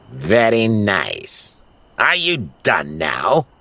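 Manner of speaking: very slow, sneering sarcasm; disgusted villain. Acted. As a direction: drag it out with contempt. Gargamel disgusted by hairball.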